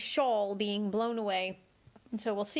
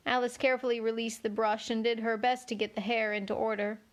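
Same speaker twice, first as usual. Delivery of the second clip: ordinary delivery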